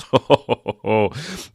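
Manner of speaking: a smug put-on cackle at his own trolling; mock gleeful laugh. Host laughing to bait conservative viewers, smug cackle. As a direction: laugh like he's poking the bear. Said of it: "See what I'm doing there?".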